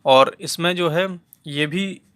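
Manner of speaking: ordinary delivery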